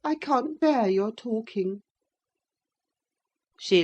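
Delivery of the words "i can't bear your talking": lightly voiced higher complaint; peevish boy. Linton cannot bear Cathy talking, mildly peevish higher voice.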